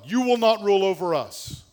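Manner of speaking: loud, high, defiant cry against God; rebellious humanity yelling at God; defiant shout. Acted. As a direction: shout it as rebels refusing a ruler. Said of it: "Rebellious humanity speaks in defiance of God".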